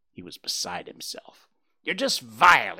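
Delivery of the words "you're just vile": louder, spat out with a falling end; one jay spits the insult; snapped insult. Acted. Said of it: "he snapped".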